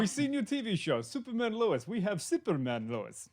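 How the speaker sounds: hammed-up Turkish accent, pitched high; a jokey, clumsy Turkish accent; bad Turkish accent. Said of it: "That's a bad Turkish accent".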